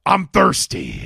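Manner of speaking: loud snarling quip; Freddy one-liner. Retold or imitated. Podcast host quoting Freddy's one-liner, loud snarl. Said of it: "So, uh, I have".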